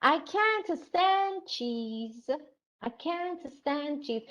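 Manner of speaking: high, drawn-out complaint; slow, high wail of distaste; acted dislike. Shown as a demonstration. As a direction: stretch CAN'T up high in dismay. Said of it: "he said".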